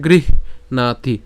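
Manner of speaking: গৃহণাতি split as "grih-naati"; h sounded first. Shown as a demonstration. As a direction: slowly breathe the "h" before "naati". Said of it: "একই রকমভাবে এখানে হচ্ছে". (গৃহণাতি, আগে হ, ধীরে)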